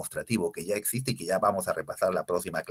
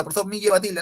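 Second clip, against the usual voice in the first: high, loud student protest; insistent student; 11 semitones higher, 7 dB louder, faster than usual. Acted. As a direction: imitate a pupil insisting to the teacher, pitched way up. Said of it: "Por más que le quieras dar fuerza". (alumno que insiste, agudo y fuerte)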